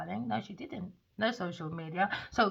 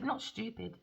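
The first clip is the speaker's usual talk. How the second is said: normal talking voice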